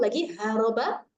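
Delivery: normal talking voice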